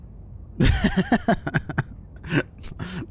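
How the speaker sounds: long, high-pitched laughing fit. Real, not acted. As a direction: lose it in high giggles. Laughs at tripping over the name.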